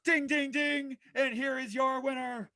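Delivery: lively ringside commentary, calling every move; wrestling play-by-play. Acted. Host calling his own wrestling show, energetic play-by-play. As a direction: call the match like a TV announcer.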